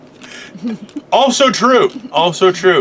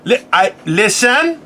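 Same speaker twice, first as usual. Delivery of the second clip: mock immigrant mom, higher-pitched; imitating instructive mother; 5 semitones higher, slower than usual. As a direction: lecture like a caricatured mother. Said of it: "they talk like this".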